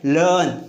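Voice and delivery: "लर्न" as luhn, r left silent; falling "लर्न" with no r before n; silent-r pronunciation. Shown as a demonstration. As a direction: drop the r, lengthen the vowel in "लर्न". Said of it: "क्या बोलते हैं?".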